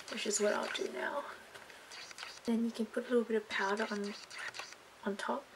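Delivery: whispering. Real, not acted. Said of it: "I have to whisper".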